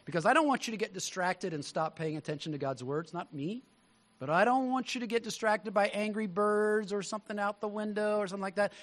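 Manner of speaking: normal talking voice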